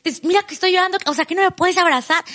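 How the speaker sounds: high, exasperated mock plea; exasperated pleading. Wife retells crying at her husband, exasperated. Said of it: "así como de".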